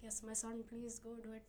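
lightly voiced Indian parent, Indian accent; doting Indian mother, light Indian accent. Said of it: "I like your Indian accent".

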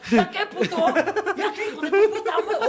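loud, high laughing jeer; mock yelling. Imitating onlookers jeering a beaten thief, loud. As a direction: shout it like a crowd taunting a thief. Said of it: "los demás le empiezan a gritar".

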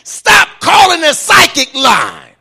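high, shouted Pentecostal-style proclamation; full-force preaching. Real, not acted. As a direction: preach it at the top of your voice.

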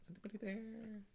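spooky call. Retold or imitated. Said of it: "I was like".

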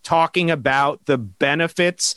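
ordinary talk, nothing marked

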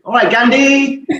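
high, drawn-out mock greeting; teasing greeting. New teammates greeting him in the dressing room, teasing. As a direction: imitate teammates slowly singling him out by nickname.

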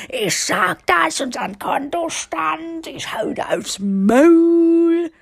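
high, drawn-out mock rant, building to a yell; exaggerated angry wife shouting; mock furious outburst. Imitates her own furious reaction.

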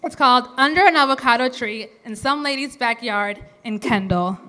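usual speaking voice

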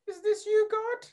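high, wide-eyed wonder at a miracle; amazed. Retold or imitated. Groom marvels as traffic clears after praying, high and amazed. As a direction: ask it like a miracle just happened. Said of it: "And I'm like".

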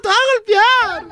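frightened plea. Retold or imitated. Host voicing the frightened worker as the man attacks, pleading. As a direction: beg frantically. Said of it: "Está golpeando".

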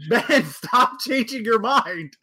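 loud, high, drawn-out mock telling-off; mock scolding. Friend scolds Ben for dithering over his paper, mock scolding.

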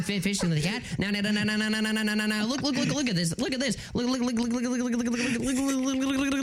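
stuttering cartoon pig; machine-gun repeats before the phrase finally gets out; Porky Pig. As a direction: stammer through it like a cartoon pig. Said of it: "Please say Porky Pig".

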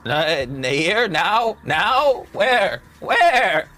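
confused goat. Acted. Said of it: "playing to the goat's intelligence".

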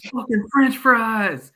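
loud, high, fed-up yell; exasperated yell. Retold or imitated. Coach losing patience with kebab cashier, yelling. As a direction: blow up at the cashier. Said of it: "And I go".

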